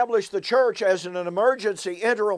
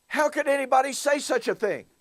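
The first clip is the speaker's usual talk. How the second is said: retold aghast question, pitched high; staggered disbelief; 7 semitones higher than usual. [name] tells Don his shock at the preacher's words, staggered. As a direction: voice a friend's staggered reaction, higher than usual. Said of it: "I was literally staggered".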